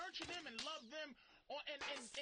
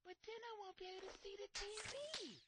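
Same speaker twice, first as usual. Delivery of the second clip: higher, thin put-on girlfriend voice; mock whiny ex-girlfriend; 8 semitones higher than usual. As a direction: imitate the ex complaining about the TV. Ex-girlfriend whining in story.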